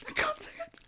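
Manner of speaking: high, whimpering whisper; crying whisper. Acted. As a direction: whisper it as if about to cry. Too shocked to say it.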